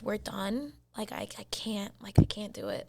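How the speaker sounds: retold breakup, nearly whispered; hushed finality. Woman grabbing her stuff to leave him, hushed finality. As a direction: drop the voice, worn down to a murmur.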